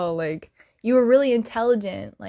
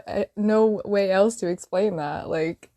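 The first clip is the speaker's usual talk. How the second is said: ordinary delivery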